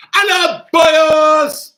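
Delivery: very high, loud hello; high, showy sing-out; mock flamboyant greeting. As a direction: call it out in a playful high voice. Jokey called-out greeting after name chat.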